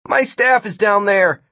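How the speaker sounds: high, insistent pointing out; urgent. A narrator's reading. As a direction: call attention from up high. Martin urgently points out staff.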